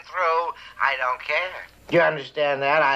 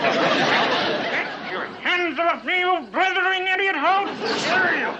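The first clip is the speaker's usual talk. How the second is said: higher, slowed, spluttering fury; indignant outburst; 10 semitones higher, slower than usual. Acted. Captain shaking off the officer, furious shout. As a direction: shove them off and rage. Captain grabbed, fighting back.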